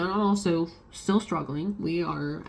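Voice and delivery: normal talking voice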